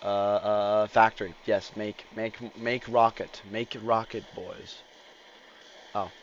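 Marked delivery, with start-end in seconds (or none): none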